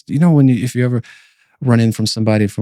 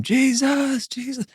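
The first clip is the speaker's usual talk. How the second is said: high, soft imitation of yelling a name; mock distant yell; 6 dB quieter, slower than usual. Man sprinting after Jesus as he vanishes, mock yell. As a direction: call out very high but held back, fading away. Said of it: "just yelling his name".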